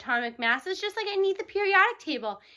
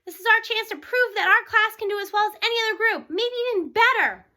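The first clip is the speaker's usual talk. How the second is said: higher and louder, rallying the class; rousing announcement; 5 semitones higher, 5 dB louder than usual. Acted. Teacher rallies his class before the state test, excited. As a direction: pump up the class like a coach. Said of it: "he announced".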